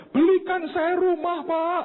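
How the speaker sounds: very high mock wail; imitated tearful plea. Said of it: "Dia nangis". (menirukan ibu merengek memohon)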